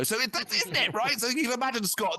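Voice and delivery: usual speaking voice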